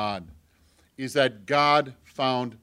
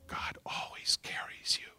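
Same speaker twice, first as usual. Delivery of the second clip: whisper; 10 dB quieter than usual. Acted.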